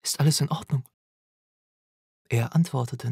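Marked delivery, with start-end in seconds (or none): 0.0-0.9 higher, worried call. A narrator's reading. Mother checking on her son in the tower, calling out. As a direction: call out a concerned question. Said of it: "rief ich".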